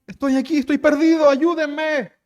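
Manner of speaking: high, sustained calling voice; castaway's cry for help. Acted. Lost castaway calls to a plane, high cry for help. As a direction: plead to be rescued, pitched well up.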